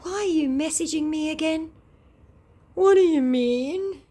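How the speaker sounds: high, loud sing-song scammer greeting. Acted. Scammer returning one morning, high loud mock greeting. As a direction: chirp hello like a chummy scammer. Voicing both sides high.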